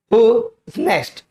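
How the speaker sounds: drawn-out, carefully spaced model sentence; slow teaching demo. Shown as a demonstration. Teacher slowly modelling who is next for students. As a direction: say it slowly so students can copy.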